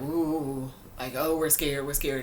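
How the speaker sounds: mock scared. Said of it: "they were laughing at him".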